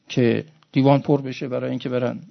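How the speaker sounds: ordinary delivery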